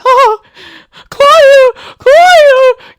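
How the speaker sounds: very high, loud panicked shriek; little-girl scream. Retold or imitated. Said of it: "I scream like a little girl".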